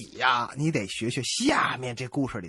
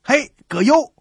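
loud, high hail across the cinema; cheeky high yell to a stranger; loud call; 12 semitones higher, 10 dB louder, slower than usual. Acted. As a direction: shout the name to grab his attention. Calling out to bald stranger.

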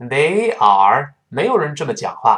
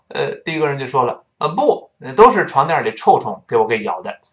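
ordinary talk, nothing marked

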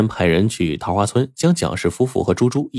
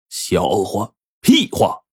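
scornful outburst; much slower than usual. Acted. Angry father rejects suitor.

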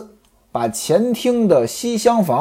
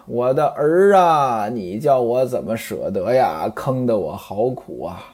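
crying lament; 7 semitones lower, 5 dB quieter than usual. A narrator's reading. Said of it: "接着哭".

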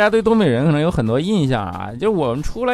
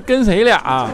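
跟谁俩 punched higher and louder; 跟谁俩 said loud as a teasing Northeastern provocation; stressed challenge; 7 semitones higher, 5 dB louder, slower than usual. Shown as a demonstration. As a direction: hit 跟谁俩 hard, like a fight starter. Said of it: "才是东北真的动手的导火索".

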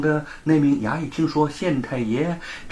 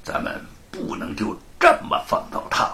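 vicious. A narrator's reading. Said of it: "罗庞恶毒地说".

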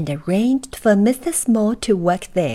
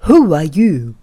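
lower, louder postman voice; postman's voice; 3 semitones lower, 8 dB louder than usual. A narrator's reading. Postman greeting a tiny man, louder and lower. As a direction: boom it down like a big postman.

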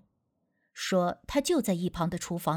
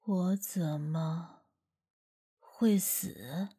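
low, slow, hushed and heavy; a ghost speaking through a boy, very slow; spirit-possessed voice; 5 semitones lower, 7 dB quieter, much slower than usual. Acted. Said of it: "鬼仙上身时说话的口气".

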